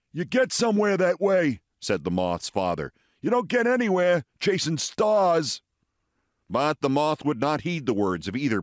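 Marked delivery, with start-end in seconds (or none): 0.1-1.6 raised, scolding father; father moth, higher voice. Acted. Father lecturing his son, mock scolding. 3.2-5.6 raised, scolding father; father moth, higher voice. Acted. Father lecturing his son, mock scolding.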